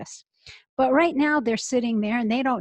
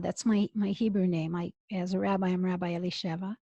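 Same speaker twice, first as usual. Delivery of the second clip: ordinary talk, nothing marked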